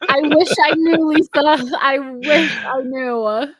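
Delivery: high, loud exclamation, then slowing right down; emphatic not-knowing. Real, not acted. Answering Lisa about the British accent mystery, loudly emphatic. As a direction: cry it out, then drag out the repeat.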